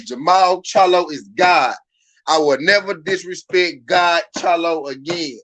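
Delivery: slow, loud, emphatic oath; say it meaningfully. Shown as a demonstration. Streamer demonstrating the forced pledge, slow and loud. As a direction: drive each word home with full conviction. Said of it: "say it like you mean it".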